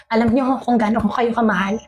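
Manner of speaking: ordinary talk, nothing marked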